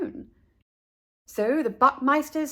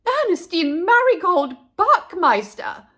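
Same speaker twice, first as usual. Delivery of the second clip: higher, louder full-name call; 7 semitones higher, 8 dB louder than usual. A narrator's reading. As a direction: call her by her full name across the hill. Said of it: "called Missus Buckmeister".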